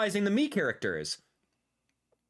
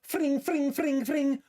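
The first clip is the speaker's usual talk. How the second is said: high-pitched mock throwing-star noise; playful fring fring star noises; ninja star whoosh; 9 semitones higher, slower than usual. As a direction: make the shuriken sound with your mouth. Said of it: "I remember going like this".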